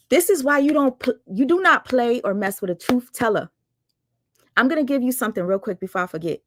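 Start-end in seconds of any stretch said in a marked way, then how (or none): none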